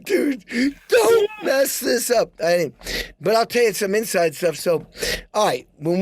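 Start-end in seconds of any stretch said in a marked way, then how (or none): 0.0-2.3 high, slow, crying plea; mock panic. Warning himself before the song, high crying plea. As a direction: beg yourself not to blow it. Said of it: "I made a big".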